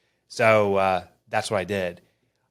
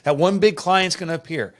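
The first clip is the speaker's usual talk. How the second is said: higher and louder, forced cheerful bluff; fake upbeat reassurance; 6 semitones higher, 6 dB louder than usual. Telling his wife all is fine, forced-cheerful and loud. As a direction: pump up false confidence to hide the truth. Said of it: "I'm like".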